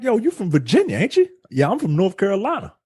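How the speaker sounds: light, high imitation of casual talk; high mock chatter. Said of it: "She straight up was like".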